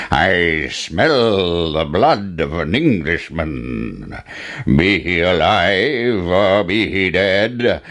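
slow booming giant chant. Acted. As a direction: chant it slowly like a huge giant.

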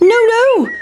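sing-song no to the chasers; high-pitched cheeky pancake. Acted. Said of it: "said the big pancake".

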